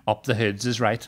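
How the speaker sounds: slow, measured agreement at an everyday volume; politely agree, taking your time; overly polite. Retold or imitated. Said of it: "overly polite about things".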